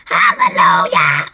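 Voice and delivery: low, loud rough cheer; loud low-pitched exclamation; shouted. A narrator's reading. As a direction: shout it out in jubilation. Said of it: "Anne Spiker shouted".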